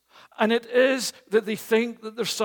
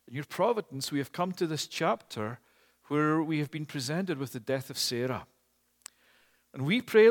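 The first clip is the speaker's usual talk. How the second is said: softer, lower prayer voice; 6 semitones lower, 4 dB quieter than usual. Acted. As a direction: lead the congregation in quiet prayer. Closing prayer.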